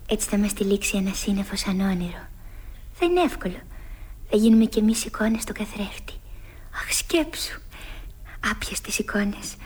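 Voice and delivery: soft, hushed dreaming; dreamy whisper. Acted. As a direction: whisper as if casting a spell. (ονειρικός ψίθυρος)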